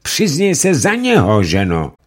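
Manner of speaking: high, loud command; commanding judge. Acted. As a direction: push her to confess. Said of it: "Rychtář řekl".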